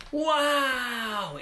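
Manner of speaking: high, drawn-out wow of amazement; gasp, then let the wow soar; awestruck gasp. Acted. Children first see the castle.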